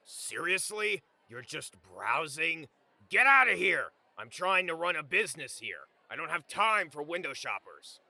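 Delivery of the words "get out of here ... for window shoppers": angry fruit seller. A narrator's reading. Vendor kicking out a penniless window shopper, angry.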